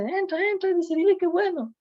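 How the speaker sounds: excited parent's urging. Retold or imitated. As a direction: squeal it like an overjoyed parent.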